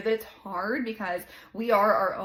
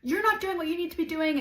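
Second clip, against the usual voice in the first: mock yelling at toddler; 7 semitones higher than usual. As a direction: bark the order at a little kid. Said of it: "yelling at her".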